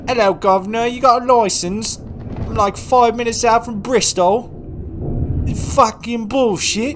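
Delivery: high, loud Cockney caricature; mock Cockney accent. Shown as a demonstration. Streamer showing the accent he doesn't mean, hammed-up loud Cockney. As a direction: blare it out in an over-the-top Cockney voice. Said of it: "Not the, not the".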